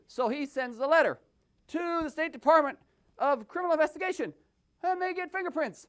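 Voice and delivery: ordinary delivery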